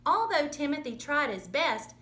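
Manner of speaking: plain reading voice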